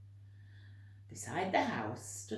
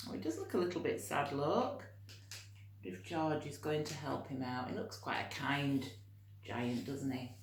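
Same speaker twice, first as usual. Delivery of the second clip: usual speaking voice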